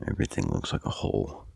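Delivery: sly whispered innuendo; hushed punchline. Shown as a demonstration. Dirty twist on the saying.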